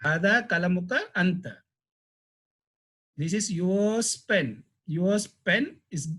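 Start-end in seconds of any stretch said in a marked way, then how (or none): 3.2-5.8 slow, stretched "yours" for emphasis; stress on YOURS. Shown as a demonstration. Teacher translating an emphatic pronoun, "yours" stressed. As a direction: draw out "yours" each time. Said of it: "this anta is give the emphasis".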